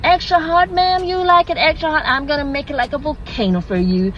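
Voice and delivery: keen American barista, starting high; over-helpful American customer-service voice; American accent. Acted. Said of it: "Sorry, forgive the accent".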